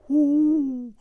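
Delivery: high, loud hooting owl. Acted. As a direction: call it out like an owl.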